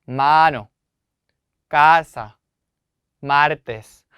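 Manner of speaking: 마노, 까사, 마르테스 with a loud, drawn-out first vowel; teacher's word-by-word demo of first-syllable stress; stress on second-last syllable. Shown as a demonstration. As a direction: hit 마 in 마노, 까 in 까사, 마 in 마르테스, then drop off. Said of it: "강세를 주셔서 발음을 하시면 됩니다".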